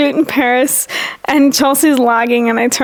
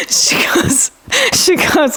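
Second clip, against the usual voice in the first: ordinary talk, nothing marked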